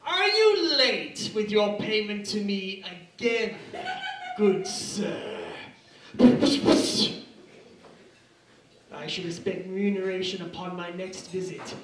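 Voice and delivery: slow, grandly indignant gentleman's threat; hammed-up Victorian menace, drawing out the key words; Victorian London tough guy. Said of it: "If this were nineteenth century Victorian London".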